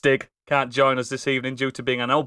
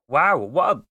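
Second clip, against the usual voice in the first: normal talking voice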